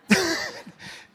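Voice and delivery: a loud, high real laugh breaks out; burst of high laughter. Real, not acted. Pastor telling his congregation, bursting into loud high laughter. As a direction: cracks up at the memory of being called fat.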